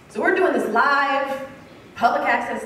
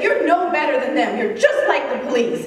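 enraged; 2 semitones higher, 4 dB louder than usual. Retold or imitated. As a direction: throw the accusation in their face. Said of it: "finally he becomes enraged".

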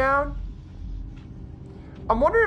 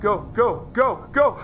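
ordinary talk

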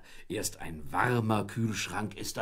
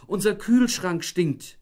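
higher, louder, slow; indignant complaint; 9 semitones higher, 7 dB louder, slower than usual. A narrator's reading. Wife telling husband the fridge stinks, indignant. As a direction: spell out the disaster.

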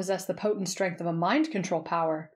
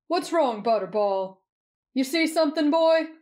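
high, raised voice for talking to a pet; guard to dog; 7 semitones higher, 5 dB louder than usual. A narrator's reading. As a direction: call to your dog in character.

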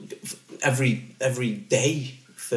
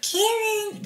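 put-on much higher childlike little-boy voice. Dad calls his baby son in a high little-kid voice. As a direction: call his name in a tiny, high kid's voice. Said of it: "I've always been able to do The Voice".

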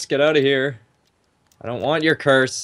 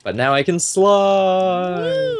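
sing-song gloat; 8 semitones higher, 4 dB louder, much slower than usual. Real, not acted. Gloating about new skill.

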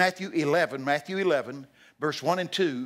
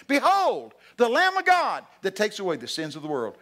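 high, loud cry settling toward the end; bold proclamation; 6 semitones higher, 5 dB louder than usual. Retold or imitated. Preacher recalling John's original calling, bold loud cry. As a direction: burst out like a herald.

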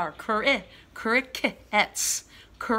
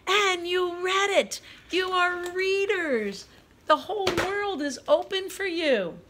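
encouraging teacher; 9 semitones higher than usual. Real, not acted. Teacher praising kids as readers.

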